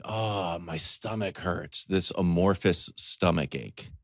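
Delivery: ordinary delivery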